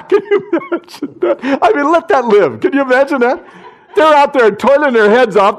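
high, loud, laughing delight; laughing in amazement. Real, not acted. Preacher marvels to the congregation, laughing and loud.

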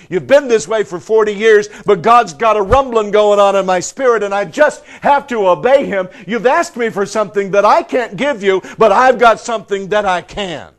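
preacher voicing a fired-up apostle; urgent proclamation. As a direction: declare it as if compelled to speak. Acts out Peter's urgent address.

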